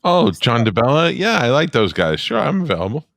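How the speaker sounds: high-pitched, eager yes to an invitation; bright-eyed mock enthusiasm, pitch climbing; keen acceptance. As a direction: act delighted to be asked. Acts out a keen, happy reply.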